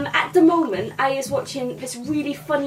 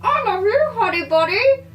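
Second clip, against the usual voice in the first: high-pitched mock lovey-dovey exchange; acted film lovers; 8 semitones higher than usual. Comedian acting out diner robbers' love exchange, mock lovey-dovey. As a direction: play film sweethearts, pitched up. Acts out Pulp Fiction lovers.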